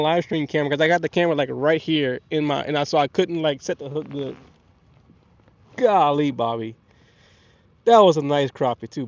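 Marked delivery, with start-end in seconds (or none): none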